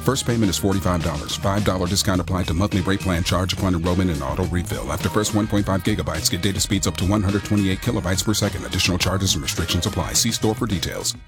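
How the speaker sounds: super fast ad read. Acted. Fast legal disclaimer at ad end.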